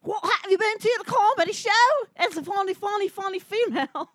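exaggerated mangled Scottish; Scottish accent. Comic auditioning as a Scot questioning an Irishman, bad Scottish. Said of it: "Scottish person talking to an Irish person".

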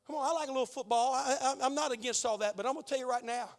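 normal talking voice

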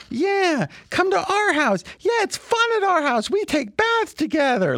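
very high, squeaky cartoon siblings; Walter and Perry. Cartoon siblings invite someone over, high squeaky voice. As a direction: hold a piercingly high cartoon-kid voice. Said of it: "they talk like this".

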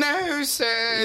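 mock polite. Mimicking polite deference.

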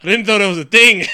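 high, loud, laughing exclamation; laughing mock outburst. Reacting to the Obama voter, loud and laughing. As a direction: blurt it out through a laugh. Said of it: "I was like".

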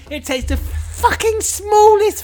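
high, slow, loud moaning; mock whiny listener. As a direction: whine like a fed-up fan. Mocking listeners' complaint.

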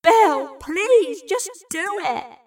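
imploring. A narrator's reading. Evie begs Bill to give in, high imploring. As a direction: implore him, voice pitched well up. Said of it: "Evie had implored".

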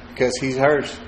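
laughing softly. Real, not acted. Teasing about a couple.

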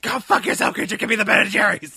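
loud, hammed-up rage; violent mock outburst. Master demanding Ben and Jerry's from elf, mock-furious yell. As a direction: yell the demand at full volume. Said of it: "This movie turned my friend violent".

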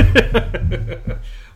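high, loud unvoiced laugh; burst of laughter. Real, not acted. Host laughing at the listener questions. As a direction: let a sudden laugh burst out.

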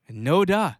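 rising, incredulous sarcasm; sarcastic. Real, not acted. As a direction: scoff at the obvious news. Mocking obvious news.

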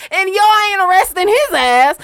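high, loud laughing protest; laughing outburst. Real, not acted. Host laughs at the creepy customer, high and loud. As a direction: laugh it out in disbelief.

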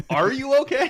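mock concern. Host pretending to check on Matt, high mock worry. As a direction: squeal it in fake alarm.

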